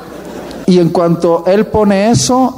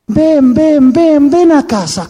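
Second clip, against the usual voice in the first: mother's welcome; 9 semitones higher, slower than usual. Acted. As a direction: play the delighted mother calling him in. Mother invites the preacher boy.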